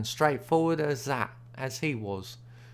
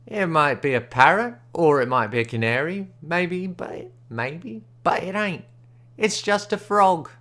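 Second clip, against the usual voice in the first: offhand, pitched-up Smiley voice, raised and louder; indifferent like; 5 semitones higher, 5 dB louder than usual. A narrator's reading. Smiley answers the stranger, sort of indifferent like. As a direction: shrug it off as if it's nothing. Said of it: "sort of indifferent like".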